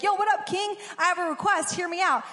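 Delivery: breezy, cheeky, strolling in on the king; hammed-up casual dude; cocky casual greeting. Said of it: "nobody could just come into the king's presence and be like".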